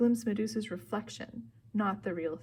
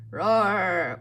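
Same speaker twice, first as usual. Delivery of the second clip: loud voiced "Roar!"; monster roar; 8 dB louder than usual. A narrator's reading. Monster roars, proving she is awake.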